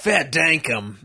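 "Fair dinkum" in put-on broad Australian; Australian accent. Shown as a demonstration. Demonstrates slang in Australian accent.

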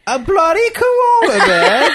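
high, loud hammed-up Aussie accent; Australian accent. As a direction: exaggerate Australian while cracking up. Corrects friend's failed Australian accent.

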